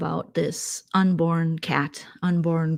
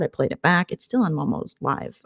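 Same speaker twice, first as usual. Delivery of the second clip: ordinary talk, nothing marked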